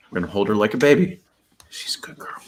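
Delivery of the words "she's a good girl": soft whisper to the dog. Real, not acted. Holding the dog like a baby.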